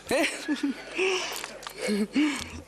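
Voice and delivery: airy rising laugh; light amused laugh. Real, not acted. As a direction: laugh at your own joke. Laughs after joke about husband.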